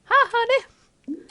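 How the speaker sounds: very high, slow hi honey; mother greets husband too sweetly; fake sweet greeting. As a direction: sing it sugary.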